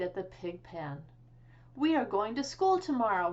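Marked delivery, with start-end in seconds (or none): 1.7-3.3 higher, louder proud announcement; eager announcement. A narrator's reading. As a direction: proclaim it to the pigs. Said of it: "he announced".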